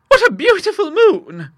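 high, loud, slow rapture; admiring old lady. Acted. Monica admiring the moon at the window, high and slow. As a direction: marvel at the moon.

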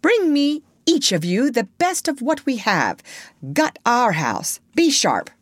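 high-pitched little leader giving orders; brisk ordering. A narrator's reading. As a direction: order the boys about. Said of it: "he ordered them".

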